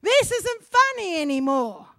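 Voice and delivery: high, loud and slow call after someone; yelling. Acted. Strawberry yelling after the departing farmer, high and loud. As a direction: shout after the farmer as he leaves. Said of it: "the strawberry's yelling".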